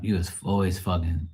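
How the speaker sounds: ordinary delivery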